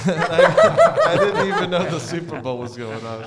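laughing. Real, not acted. Laughing at own cluelessness.

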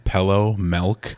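"Pello" and "Melk" with short E vowels; Minnesota accent. Shown as a demonstration. Listing words the local way, Minnesota accent. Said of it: "Minnesota ones are I's and E's".

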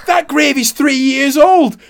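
high, loud, slow, appalled; mock the boss in disgust; outraged boss. The boss, high and loud, telling him the gravy is three years old.

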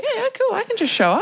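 carefree mock voice. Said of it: "It's like".

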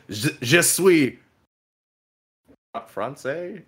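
faltering mock-French declaration; failed boldness. Shown as a demonstration. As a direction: start big, then lose nerve on the last word. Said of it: "I can't speak that boldly".

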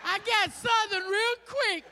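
high, slow, drawn-out admission; emphatic punchline. Real, not acted. Storyteller owning up to saying y'all, emphatic comic punchline. Joking about his sudden Southern act.

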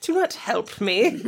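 slow haughty lady voice; evil queen. Retold or imitated. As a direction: draw it out like a scornful queen. Voicing the rude lady.

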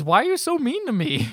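high-pitched, loud mock complaint; acted protest. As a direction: wail it as the wronged man in the story. Acted quarrel, man's wounded protest.

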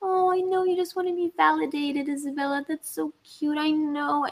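put-on high, soft coo like comforting a child; cooing to yourself as to a little kid; self-soothing. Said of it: "I do a lot of self-soothing".

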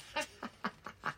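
soft, voiceless gloating laugh; airy mocking laugh. Bunny laughing at the tired leprechaun. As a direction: laugh at him under your breath. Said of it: "the bunny just laughed".

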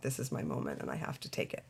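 dropped low and soft, the second half whispered; quiet murmured thought trailing into a whisper; hushed inner thought. Retold or imitated. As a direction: say it to yourself like a private resolve. Retold inner thought on moving.

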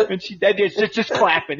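usual speaking voice

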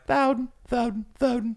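high sing-song granny voice; grandma voice. Acted. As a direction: croon the name like a grandma. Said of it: "what's your favorite word to say in grandma voice?".

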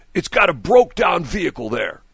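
imitated fussy caller; complaining caller. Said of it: "He says".

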